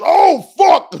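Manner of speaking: high, loud panicked yell; startled yell at the tractor; top of his lungs. Retold or imitated. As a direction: scream it out in fright. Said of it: "just at the top of his lungs".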